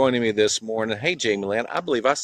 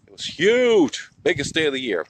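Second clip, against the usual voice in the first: excited; 7 semitones higher, 5 dB louder than usual. Real, not acted. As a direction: stretch "huge" up high in wonder. Recalling the parade excitement.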